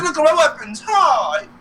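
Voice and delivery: high cartoon show-off; Bowser. Retelling Bowser showing his wife weapons, high boastful voice. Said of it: "He's like".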